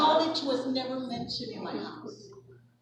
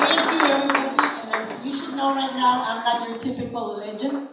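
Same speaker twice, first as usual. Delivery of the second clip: calling out over the crowd, fast; big excited thank-you to the crowd; loud excited address; 7 dB louder than usual. Real, not acted. Honoree calls out to the crowd, loud and excited.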